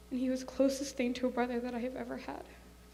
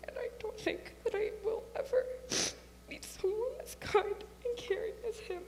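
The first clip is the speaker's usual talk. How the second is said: crying through the words, pitched up; 9 semitones higher than usual. Real, not acted. Sister mourning Joe at his memorial, crying. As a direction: voice breaking into real tears. Eulogy for her lost brother.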